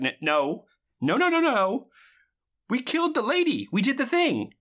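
a hammed-up frantic no; panicked denial. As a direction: squeak out the denial. Ahmed panicking quote.

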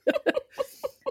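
high squeal of laughter; high burst of laughter. Real, not acted. Laughing at story.

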